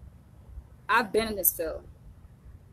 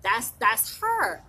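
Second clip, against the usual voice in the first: mock-certain pointing out the voice; that's her, said slowly and emphatically; excited recognition; 3 semitones higher, 8 dB louder, slower than usual. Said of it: "It's like".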